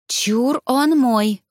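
playful, stretched-out blurt; blurted claim. A narrator's reading. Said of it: "выпалила подруга".

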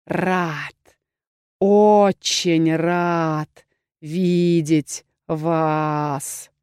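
low, rasping troll voice; deep hoarse troll. A narrator's reading. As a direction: drawl it from deep in the chest like a troll. Troll speaks again. (низкий хриплый голос тролля)

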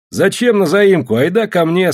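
loud, high-pitched urging; hearty invitation. A narrator's reading. Neighbour urges guests to his place, hearty calling. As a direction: call them over insistently.

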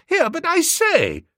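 high-pitched spluttering objection; flustered, high interjection; startled protest. A narrator's reading. Narrator protests at being left.

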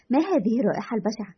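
ordinary talk

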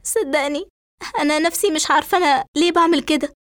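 high, tearful, rushed confession; acted weeping, words tumbling out and halting; crying. A narrator's reading. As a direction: plead to be believed through tears. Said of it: "فترد إسراء وهي تبكي".

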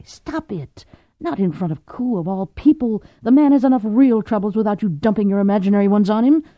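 upset woman rebuking herself; Cordelia scolding herself. A narrator's reading. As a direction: snap at yourself to stop crying.

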